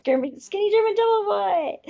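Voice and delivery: high, held-out mock announcer call; hammed-up stage name. Echoing a juggler friend's stage name, hammed-up high call. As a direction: sing it out high, stretching the last words.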